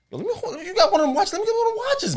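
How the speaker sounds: begging for a watch. Retold or imitated.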